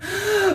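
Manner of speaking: sharp audible gasp; sudden high intake of breath; quick real gasp. Real, not acted. Sudden breath before addressing Ruby.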